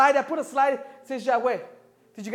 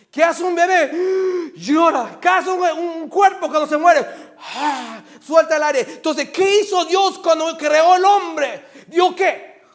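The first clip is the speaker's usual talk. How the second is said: high, loud rhetorical questions, hammered answers; impassioned pulpit crying out; full-force preaching; 11 semitones higher, 13 dB louder, slower than usual. Real, not acted. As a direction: shout the sermon with rising urgency.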